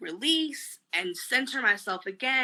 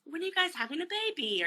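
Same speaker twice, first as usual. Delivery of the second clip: high, mock-eager relative's question; chirpy imitation of nosy family; prying relatives; 10 semitones higher than usual. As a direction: imitate a relative asking, pitched way up.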